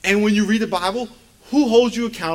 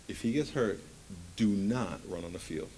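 low and soft, measured instruction; firm, quiet warning; 10 semitones lower, 11 dB quieter than usual. Retold or imitated. Husband warning wife before son's game, quiet and firm. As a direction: lay it down quietly, slowing at the end.

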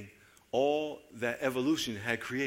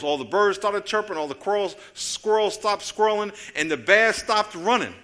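ordinary talk, nothing marked

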